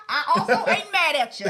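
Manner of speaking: laughing through a [name] line; quoting [name] with a laugh; laughing [name] quote. Retold or imitated. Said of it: "That brings us to the [name]".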